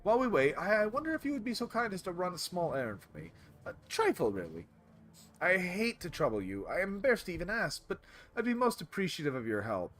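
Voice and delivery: high, wheedling polite vendor voice; oh-so-courteous shopkeeper angling for a favour; butter them up apologetically, pitched high; ingratiating Turkish vendor. Acted.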